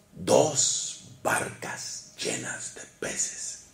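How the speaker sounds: slow, hushed whisper. Shown as a demonstration. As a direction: whisper it in awe, very slowly. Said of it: "repeats the line, then whispers it". (susurro lento y asombrado)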